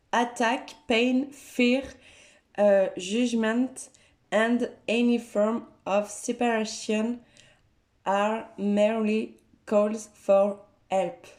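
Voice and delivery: slow, careful English read with a French accent. Real, not acted. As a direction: read the card aloud in French-accented English, taking it slowly. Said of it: "désolée pour mon accent qui est not very good".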